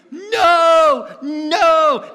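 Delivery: high, loud panicked scream. Retold or imitated. Woman on the subway screaming at the singer, very loud. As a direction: scream no at the man. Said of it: "started screaming".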